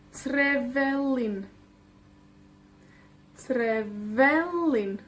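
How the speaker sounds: slow Trevelin with VE drawn out; tre-VE-lin, slow and falling; stress on penultimate. Shown as a demonstration. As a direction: stretch Trevelin and lean on VE. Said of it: "La penúltima".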